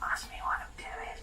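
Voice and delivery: hushed unvoiced whisper. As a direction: whisper it like a secret. Playful whispered request to partner.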